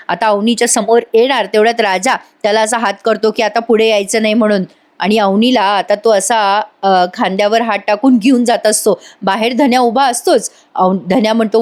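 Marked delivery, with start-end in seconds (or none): none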